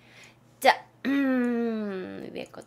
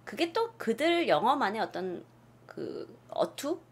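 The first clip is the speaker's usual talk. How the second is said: usual speaking voice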